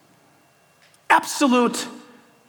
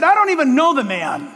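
denying Peter; 6 semitones higher than usual. Acted. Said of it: "And I said".

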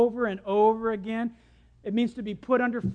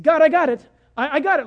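loud, higher protest; impatient insistence; 3 semitones higher, 11 dB louder, faster than usual. Retold or imitated. Man telling God he has it, impatient insistence. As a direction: brush off help loudly and impatiently. Retold brushing off God's help.

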